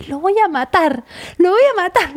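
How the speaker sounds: high-pitched retold rage, building; mock murderous exasperation, rising; building fury. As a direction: act out being pushed to the edge. Retells fury building at husband.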